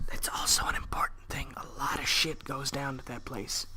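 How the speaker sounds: whispered aside. Real, not acted. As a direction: lean in and whisper it. Whispered aside during reading.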